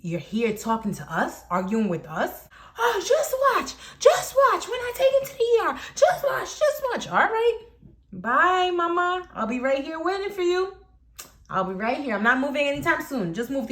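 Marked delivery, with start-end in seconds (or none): 2.8-7.6 very high mock-hysterical warning; threatening mother. Storyteller mimics the mother threatening an ER trip, high mock threat. 8.1-10.8 high, singsong mock sweetness; taunting farewell. Teller waves off the threatening mother, high taunting goodbye.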